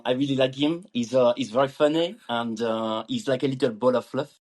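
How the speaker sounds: hesitant put-on French accent. Shown as a demonstration. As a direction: speak English like a Frenchman feeling for words. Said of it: "say puppy in a French accent".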